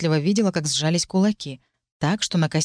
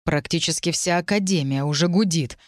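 ordinary delivery, nothing marked

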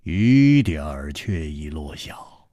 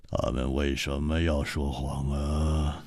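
softer, laughing and lower; laughing it off gently; 5 semitones lower, 9 dB quieter than usual. Acted. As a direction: chuckle the question off, quiet. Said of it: "胡铁花笑了".

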